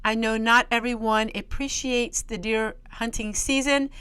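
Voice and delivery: usual speaking voice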